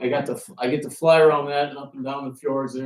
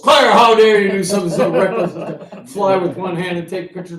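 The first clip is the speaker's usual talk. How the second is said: hammed-up scolding, higher and louder, with a laugh; mock stern dad; 5 semitones higher, 7 dB louder than usual. Dad teasing his daughter over the controls, mock scolding. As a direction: play the outraged father, half laughing.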